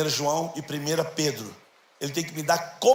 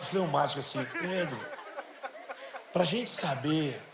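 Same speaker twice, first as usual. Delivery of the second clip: very calm; 3 semitones lower, 5 dB quieter than usual. Retold or imitated. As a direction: speak as a patient calm pastor. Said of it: "com muita calma, assim, bem calma". (pastor amigo fala devagar, bem calmo e baixo)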